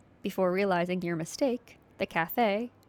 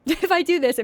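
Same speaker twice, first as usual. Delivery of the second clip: high, loud burst; sudden loud excited aside; excited outburst; 8 semitones higher, 7 dB louder than usual. Real, not acted. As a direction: blurt it out excitedly.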